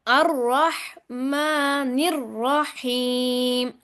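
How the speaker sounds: अर्रहमानिर्रहीम chanted slowly with a doubled, heavy र; slow Quran recitation. Shown as a demonstration. Teacher models the verse for students to repeat. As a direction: hold the vowels and hit the shadda. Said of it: "दोहराएं, सुनाएं".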